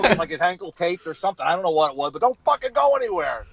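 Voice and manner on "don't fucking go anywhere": high, pushy order; mock yell. Star ordering the comedian to stay, high mock yell. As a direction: bark it, half joking.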